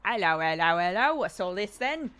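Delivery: mock London copper greeting; hammed-up British policeman, loud; playing a bobby on the beat. British police officer impression.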